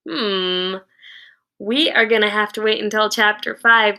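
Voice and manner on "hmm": ordinary delivery, nothing marked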